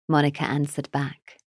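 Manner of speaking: usual speaking voice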